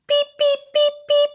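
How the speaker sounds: very high, sleepy little peeps; chick peeps. Acted. Warm sleepy chicks peeping in the incubator, tiny high peeps. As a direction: peep like a drowsy baby chick. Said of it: "very softly".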